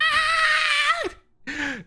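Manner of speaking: sudden high shriek of fright; startled scream. Real, not acted. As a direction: jump and shriek. Startled by the whispered voice.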